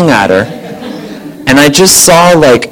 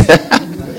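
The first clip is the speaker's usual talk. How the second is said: short airy laugh; 4 dB quieter than usual. Real, not acted. Laughs at the quote.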